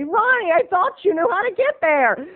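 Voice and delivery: very high accusing mom. Retold or imitated. Wife blaming husband for getting lost, very high. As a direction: needle him like an annoyed wife. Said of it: "my mom would look up ... and say".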